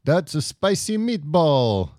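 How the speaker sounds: high, mock Italian accent; a put-on Italian fact host. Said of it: "we're just gonna do facts in an Italian accent".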